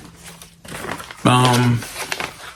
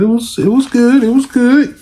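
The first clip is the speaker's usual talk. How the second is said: much higher, faux innocent; innocent lie; 11 semitones higher than usual. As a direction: play the angel. Son lying innocently to mother.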